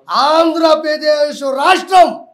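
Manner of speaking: furious speaker to reporters, a slow loud shout; emphatic shout. Real, not acted. Heated rant about the state.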